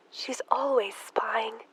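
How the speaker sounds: a fearful whisper about the sister upstairs. Acted. Emma whispering to the maid about Lizzie spying. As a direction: breathe it so she can't hear.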